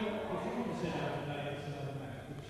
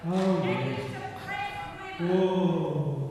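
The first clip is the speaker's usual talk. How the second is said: loud exclamation; 8 dB louder, much slower than usual. Real, not acted. Organizer silencing heckler at meeting.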